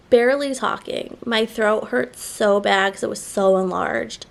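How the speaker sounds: usual speaking voice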